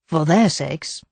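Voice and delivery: ordinary delivery, nothing marked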